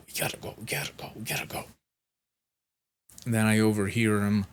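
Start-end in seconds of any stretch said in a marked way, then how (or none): 0.1-1.7 hushed, fast whisper, almost no voice; urgent whisper. Retold or imitated. Assistant urging a coworker out quickly, in a fast whisper. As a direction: hurry them out in a breathless whisper.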